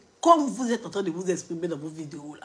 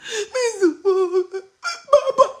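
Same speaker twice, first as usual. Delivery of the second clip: high, loud sobbing cry; hammed-up wail of the crying woman; mock crying; 12 semitones higher, 10 dB louder, much slower than usual. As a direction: imitate her bawling. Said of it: "Elle pleure seulement".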